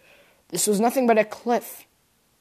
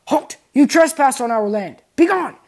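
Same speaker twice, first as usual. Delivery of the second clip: commanding shout; 6 semitones higher, 9 dB louder than usual. Acted. Guards confront the heroes.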